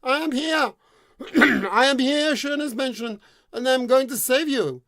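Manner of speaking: high, loud call up the tower; heroic shout. Acted. The prince shouts up to the deaf maiden, heroic and loud. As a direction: proclaim it to the tower top.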